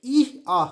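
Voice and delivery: loud, two-part donkey bray; teacher imitating a donkey's hee-haw. Shown as a demonstration. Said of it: "उसका sound कैसे निकलता है".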